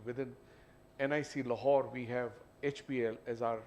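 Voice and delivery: ordinary delivery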